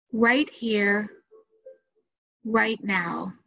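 measured meditation guide, long pauses between phrases; unhurried guided-meditation delivery with room to breathe. Acted. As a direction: lead listeners gently through it, phrase by phrase.

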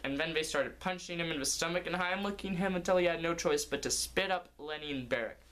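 plain reading voice

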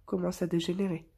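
usual speaking voice

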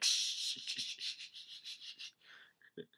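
string of shushes fading away; repeated hushing. Retold or imitated. Retelling the hushing over the lie, repeated shushes.

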